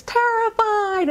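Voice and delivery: one slow, high, frightened word; terrified child. Retold or imitated. Hypnotized client answering her therapist, slow and frightened. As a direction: stretch it out small and scared. Client names feeling in regression.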